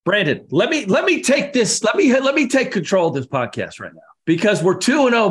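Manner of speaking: excited. Real, not acted. Grabbing control of the show.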